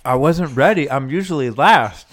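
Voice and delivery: talking while eating; words round a bite of food; mouth full. Real, not acted. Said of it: "Is there something in your mouth right now".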